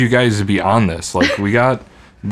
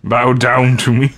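Scorpion King; 2 semitones higher, slower than usual. Pretend. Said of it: "really bad C G I Dwayne The Rock Johnson".

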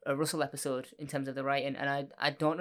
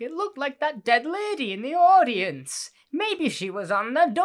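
much higher, louder, slow hammy reading voice; hammed-up Dickens; 10 semitones higher, 8 dB louder, slower than usual. Dickens pointing at a woman in the audience, hammed up and spooky. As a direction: ham up a spooky storyteller. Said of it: "And he's like".

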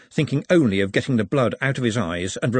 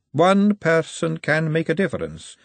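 Margalotta; 7 semitones higher than usual. A narrator's reading. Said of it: "said Margalotta".

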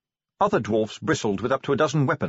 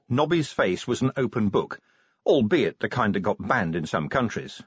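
ordinary delivery

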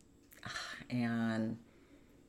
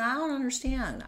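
higher, louder retold protest; exasperated protest; 12 semitones higher, 5 dB louder than usual. Woman retelling her challenge to her partner, exasperated. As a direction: push back in bewildered protest.

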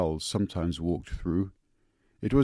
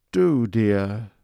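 cooing; 4 semitones higher, 10 dB louder than usual. Acted. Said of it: "cooed Joes".

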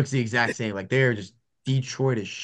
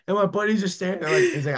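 usual speaking voice

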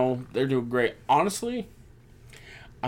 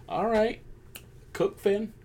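egging him on, high; 10 semitones higher, 5 dB quieter, slower than usual. Retold or imitated. Cheering Finn on.

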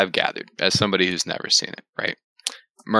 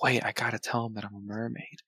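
hushed mermaid; 2 semitones higher, 11 dB quieter than usual. Retold or imitated. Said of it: "she's like".

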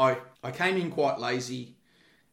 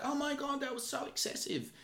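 high, soft imitation of an appalled bystander; mock shocked onlookers; 13 semitones higher, 7 dB quieter than usual. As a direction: put on a high-pitched scandalised voice. Voicing shocked onlookers outside.

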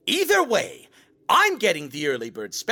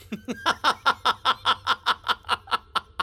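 laughing; 3 semitones higher than usual. Acted. Laughs at own pun.

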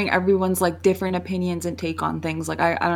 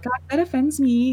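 higher-pitched joking indignation; mock offended; 6 semitones higher than usual. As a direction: play at being offended, kidding. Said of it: "I was, like, kinda joking too".

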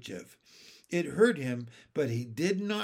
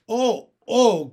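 high, loud excited oh oh; a little boy bursting with joy; loud eager little-boy cry; 7 semitones higher, 14 dB louder than usual. A narrator's reading. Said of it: "cried Benny".

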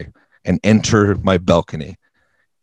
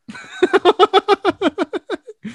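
high, loud burst of laughter; big laugh; 5 dB louder than usual. Real, not acted. Finishing the absurd story for the host, big laugh. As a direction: crack up at your own story.

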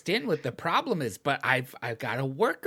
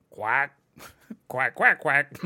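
playful duck quacks; quacking like a duck; slower than usual. Pretend. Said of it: "just be like".

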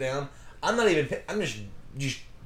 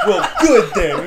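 very loud, high mock retort; retold angry retort; 8 semitones higher, 14 dB louder, slower than usual. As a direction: act out storming off, half laughing. Coworker storms out of office.

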